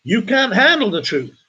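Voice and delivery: high-pitched dramatic movie outburst; hammed-up movie line; film actor impression. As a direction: cry it out like a hammy film star. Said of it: "best impersonation of that actor".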